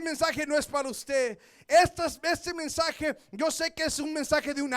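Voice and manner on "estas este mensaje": ordinary talk, nothing marked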